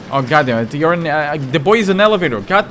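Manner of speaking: loud cry, climbing in pitch; exasperated outburst. Pretend. Player finds the boy is an elevator, exasperated outburst. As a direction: burst out loudly in exasperation.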